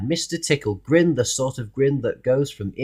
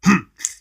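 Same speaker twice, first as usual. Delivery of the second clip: grumpy grunt; 6 semitones higher, 4 dB louder than usual. Acted. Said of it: "grunted Mister Grumpy".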